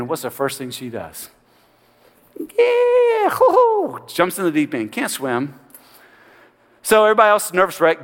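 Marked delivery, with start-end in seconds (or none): 2.4-4.0 put-on high, playful whoop of a child jumping in; gleeful whoop. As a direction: squeal it like a kid leaping into the water. Mimics girl jumping gleefully.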